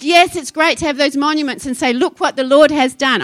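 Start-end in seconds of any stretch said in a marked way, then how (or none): none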